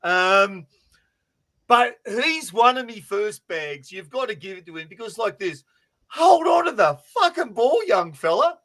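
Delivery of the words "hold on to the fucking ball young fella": high, loud exasperated plea; exasperated scolding. Acted. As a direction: scold the rookie loudly, pitch jumping up. Scolding the rookie who dropped it.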